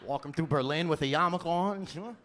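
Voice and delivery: ordinary delivery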